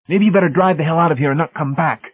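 high, loud, hostile order; hostile dismissal. Acted. Technician ordering Hamilton out, hostile. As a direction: drive him off, pitched right up.

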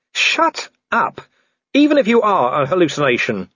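high-pitched angry snap; exasperated outburst. Acted. Vimes silencing Death in the tree, exasperated. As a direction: snap at a pestering apparition.

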